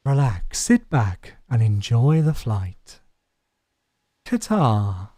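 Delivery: low, slow, projected cabin announcement; smooth airline advert voice, deep and deliberate; mock airline announcer. Streamer improvising an airline slogan for passengers, low announcer voice.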